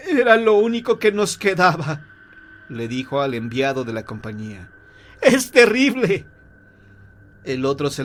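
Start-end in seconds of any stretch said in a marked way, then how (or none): 0.1-2.0 high, loud lament; anguished father. Acted. Bereaved father to company envoy, anguished. Stunned father learns son is dead. 5.2-6.2 slow, high, loud cry of grief; anguished lament. Acted. Bereaved father to company envoy, anguished.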